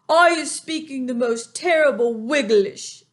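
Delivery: high, loud friendly giant with a muddled tongue; BFG voice. A narrator's reading. Reader voices the giant to listeners. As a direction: lift the voice into the big kind giant.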